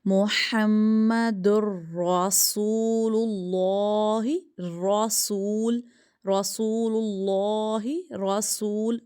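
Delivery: slow recitation, "رسول" starting with a rounded 'raw'; thick ra. Shown as a demonstration. Teacher reciting the kalima for pupils, thick ra. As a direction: draw out "رسول اللہ" with a heavy ra. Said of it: "را موٹا".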